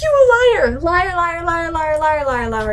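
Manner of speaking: high shrieked accusation, falling in pitch as it repeats; mock accusation. Arya calling out her sister's lie, high mock outrage. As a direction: rattle it off like an outraged child.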